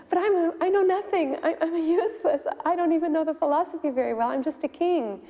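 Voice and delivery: high-pitched, helpless self-deprecation; flustered protest. Retold or imitated. King telling Prabhupada he is useless, flustered protest.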